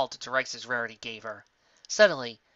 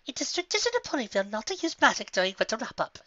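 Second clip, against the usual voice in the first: higher, prim lecturing; lightly voiced stern Rarity; 10 semitones higher than usual. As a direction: correct her primly.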